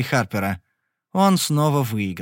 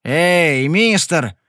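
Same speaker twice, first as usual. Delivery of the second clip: calling out; 3 semitones higher, 4 dB louder, much slower than usual. A narrator's reading. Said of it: "окликнул он".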